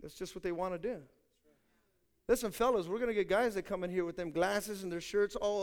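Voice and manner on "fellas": ordinary talk, nothing marked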